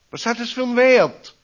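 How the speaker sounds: high, loud, fast cheeky question; challenging teen question. Retold or imitated. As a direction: fire it off like a cheeky teenager. (hoch, laut und schnell, frech fragend)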